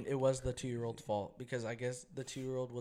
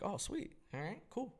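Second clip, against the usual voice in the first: higher-pitched retold agreement; pleased acceptance; 8 semitones higher, 4 dB quieter than usual. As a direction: lightly act out being pleased. Retelling his pleased reply to nurse.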